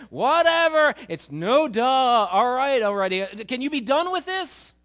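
hammed-up impatient disciples; mock exasperated. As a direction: sound fed up with hearing it again. Disciples' bored reaction to Jesus.

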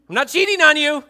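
pleading insistence. Retold or imitated. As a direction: insist urgently she's faithful. Mary insists to Joseph.

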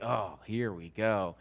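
low, slow, soft grumble; weary resignation. Acted. Husband dreads his wife's interruption, wearily resigned. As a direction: sigh it out like a husband who has heard it all.

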